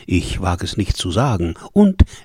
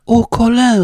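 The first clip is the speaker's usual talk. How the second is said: entzückter Ausruf; 12 semitones higher, 8 dB louder than usual. A narrator's reading. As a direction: call out to the lover, enraptured. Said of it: "rief entzücket die schöne Schäferin". (entzückt und hoch gerufen)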